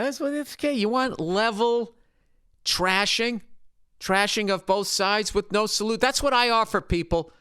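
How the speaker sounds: high, hammy sales pitch; mock salesman pitch. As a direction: sell it like an infomercial.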